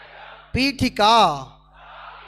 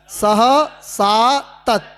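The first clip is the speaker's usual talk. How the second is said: slow clear answer; 3 dB louder, much slower than usual. Shown as a demonstration. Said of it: "किम योजयन्ति?".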